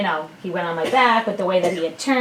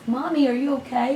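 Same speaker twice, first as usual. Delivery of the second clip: a hint of a small child, pitched up; lightly imitated little son; 6 semitones higher than usual. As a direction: lightly voice a child checking on mommy. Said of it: "he, like, looked at me and he's".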